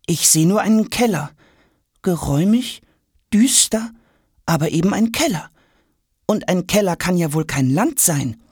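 put-on high-pitched sceptical boy; doubting boy. A narrator's reading. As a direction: voice a boy who is not convinced, high. Boy Jonas doubts the cellar. (skeptischer Junge, hohe Stimme)

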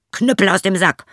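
high, loud ringing call; shouted command. Acted. Journeyman orders the cudgel out of the sack, loud shout. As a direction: bark the magic words at the attackers. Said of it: "so sprach er".

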